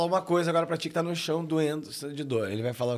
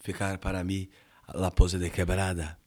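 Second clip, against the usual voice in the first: a strange man asks for the pose, low and slow; low slow character voice; 9 semitones lower than usual. Acted.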